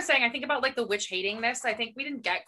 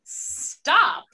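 exasperated; 8 semitones higher, 6 dB louder than usual. Retold or imitated. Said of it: "I was like".

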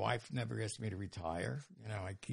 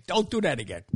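louder, higher mock telling-off; a firm retold reprimand, pushed louder; retold scolding; 5 semitones higher, 9 dB louder than usual. Program staff scolding him in story.

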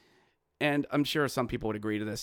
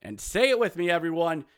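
ordinary delivery

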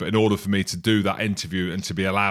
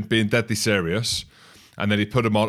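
usual speaking voice

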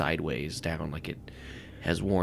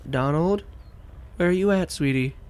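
higher, louder sweet call; wife calling for her husband, sweet and raised; woman calling; 10 semitones higher, 4 dB louder than usual. Acted. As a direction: call out sweetly through the house for Donald. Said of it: "the sound of a woman's voice".

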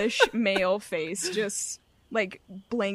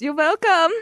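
high, loud, showy character voice; smug Val; 7 semitones higher, 8 dB louder than usual. Acted. Val answering thanks with twiddled fingers, showy. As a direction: sing out a teasing reply, pitched up. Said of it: "Val twiddles his fingers and says".

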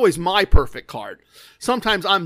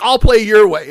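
loud mock-angry concession; imitating anger; 2 semitones higher, 9 dB louder than usual. Mad gambler agreeing to play their way, retold anger. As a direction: concede loudly, as a mad player would. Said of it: "now he's really mad".

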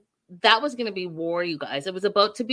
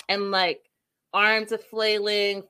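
usual speaking voice

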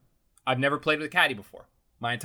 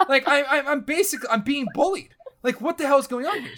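shocked disbelief; 11 semitones higher than usual. Retold or imitated. Golfer reacting to the rude caddy, high and panicked. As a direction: squeal it like a bullied kid.

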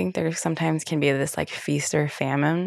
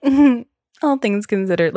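louder, higher knowing "Mm-hmm, all things considered" with falling end; knowing therapist echo; 6 semitones higher, 6 dB louder than usual. Retold or imitated. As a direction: echo "all things considered" back, pointedly, like a therapist who sees through it. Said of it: "She was like".

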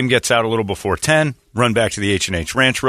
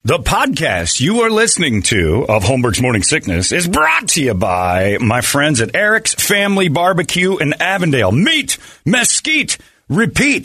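upbeat sponsor read, stretched slogan; 4 semitones higher than usual. Acted. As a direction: sell it like a radio ad. Host reads a sponsor ad.

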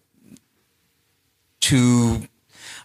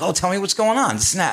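high, chirpy imitation of a friendly volunteer; mock a cheery jogger's small talk; chatty volunteer; 10 semitones higher, faster than usual. Mimicking the chatty morning volunteers, high and chirpy.

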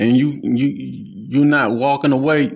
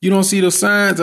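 higher, exasperated disbelief; upset at people ignoring the signs, higher and exasperated; 8 semitones higher than usual. Retold or imitated. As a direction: throw up your hands, voice pitched up. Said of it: "I just get upset sometimes".

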